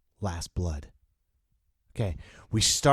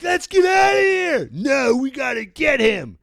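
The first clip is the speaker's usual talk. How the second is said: shouted far higher and louder; panicked yell; 7 dB louder than usual. Acted. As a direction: holler over the blasts in a panic. Panicking henchmen amid explosions.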